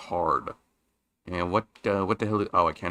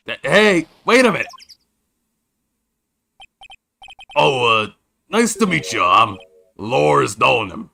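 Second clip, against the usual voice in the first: [name]'s put-on voice; 3 semitones higher, 6 dB louder than usual. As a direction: play him brash and flustered. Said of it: "What's the voice I came up with him?".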